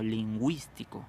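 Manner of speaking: slow "lingüístico" with the U clearly heard, "lin-GWIS-ti-co"; sounded U. Shown as a demonstration. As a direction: draw out "lingüístico", voicing the ü as a W glide. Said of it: "acá sí lo estamos pronunciando".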